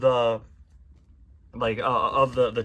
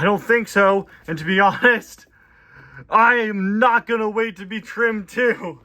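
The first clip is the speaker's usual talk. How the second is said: raised, slowed cartoon voice; cartoon character voice; 9 semitones higher, 9 dB louder, slower than usual. Reading comic aloud, a lightly cartoon scared character. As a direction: play the nervous little character. Lawn mower character line.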